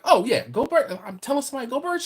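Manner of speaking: high, quick imitation of an eager girl; eager agreement. Girl agreeing to pass on the cheer, eager imitation.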